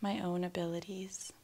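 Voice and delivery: calm, unhurried affirmation with long silences after; spoken affirmations. Acted. Guide giving affirmations for listeners to repeat, calm meditation voice. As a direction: say each statement gently, leaving room to repeat it.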